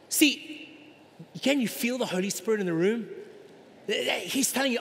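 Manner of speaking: ordinary delivery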